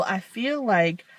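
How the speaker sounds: ordinary delivery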